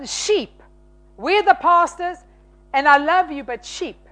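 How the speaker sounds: usual speaking voice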